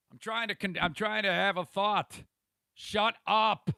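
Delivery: annoyed, tell the chat to shut up; real irritation. Real, not acted. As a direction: pitch up, snapping at the chat. Annoyed at interruptions.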